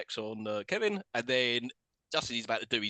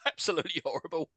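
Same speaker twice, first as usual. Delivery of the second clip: usual speaking voice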